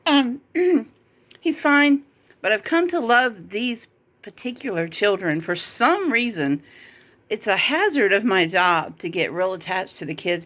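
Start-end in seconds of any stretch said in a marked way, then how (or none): 0.0-6.6 struggling through tears; blubbering. Real, not acted. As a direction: voice breaking, pitched up. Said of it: "I'm getting choked up".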